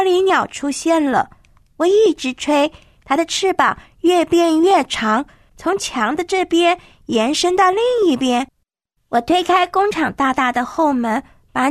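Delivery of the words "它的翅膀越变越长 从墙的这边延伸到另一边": plain reading voice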